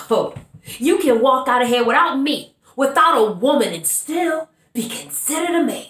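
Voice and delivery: strong-willed woman confronting a man, building then sinking; Bernice monologue. Acted. Bernice challenging Avery about being a woman without a man. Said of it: "playing the character of Bernice".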